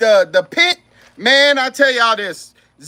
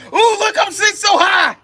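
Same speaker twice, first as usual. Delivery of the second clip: excited; 8 semitones higher, 3 dB louder than usual. Real, not acted. Joking about sitting high.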